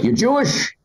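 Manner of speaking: high-pitched put-on query; pushy stranger imitation. Guy approaches after show, blurting mock query.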